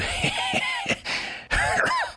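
high, wild cackling laugh; a hag's shrieking cackle. A narrator's reading. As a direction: let out a crazed cackle. Ma cackles after teasing.

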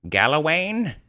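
high, rising-falling summons; calling out. Acted. Wizard summoning the elf, calling out. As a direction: call him forward grandly. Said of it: "called Grand Alf".

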